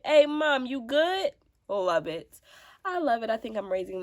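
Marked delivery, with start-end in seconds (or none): 0.0-1.3 put-on high, louder child voice; high, louder checking-in child; kid voice. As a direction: call it up like a worried kid. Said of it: "they be like".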